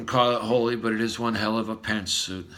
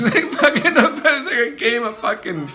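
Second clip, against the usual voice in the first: laughing, loud and high; 6 semitones higher, 7 dB louder than usual. Real, not acted. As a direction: talk through real laughter. Laughing through the story.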